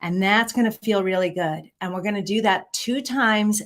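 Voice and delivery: ordinary delivery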